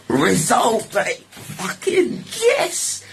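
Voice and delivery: high, drawn-out laughing cheer; triumphant glee. Real, not acted. Storyteller celebrating his release, high laughing cheer. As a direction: celebrate like you just won.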